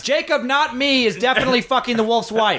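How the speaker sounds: very high-pitched mock protest; calling up. Trapped rider to rescuer, blaming someone else, high-pitched calling. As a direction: call it out high, breaking into a laugh.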